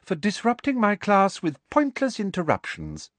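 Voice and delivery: a smooth, sugary reprimand; smooth Umbridge. A narrator's reading. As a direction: purr it out, sweetly smug. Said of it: "said Professor Umbridge smoothly".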